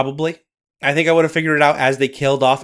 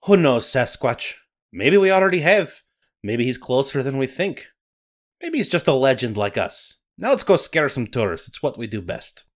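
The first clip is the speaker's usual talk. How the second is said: put-on Scottish accent. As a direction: narrate like a Highland storyteller. Nessie narration.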